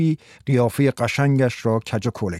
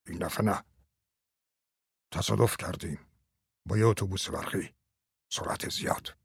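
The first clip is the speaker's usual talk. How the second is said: low, hushed and much softer, nearly a whisper; very quiet; 5 semitones lower, 11 dB quieter than usual. Acted. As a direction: say it under your breath, holding back the shock. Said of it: "درایر خیلی آرام گفت". (خیلی آرام و آهسته، تقریباً نجوا)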